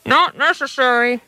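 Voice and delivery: muffled creature voice, as if through netting. Acted. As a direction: speak muffled, like through a hand. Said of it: "a muffled voice said".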